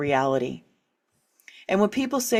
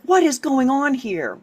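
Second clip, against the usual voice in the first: puzzled wonder; 6 semitones higher, 4 dB louder than usual. Retold or imitated.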